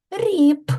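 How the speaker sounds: "Ріп" high, ending in a firm released "п"; emphasized hard final. Shown as a demonstration. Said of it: "А м'який чи твердий".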